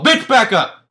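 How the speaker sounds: loud, high yell; mock angry warning. Boyfriend warns the one after his girl, loud mock-angry yell. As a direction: bark it to ward off a rival.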